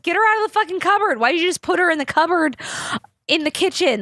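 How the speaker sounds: high, fast, imitating mom's alarmed scolding; frantic scolding mom. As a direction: rattle it off like a frightened mom. Mom yelling about the cat.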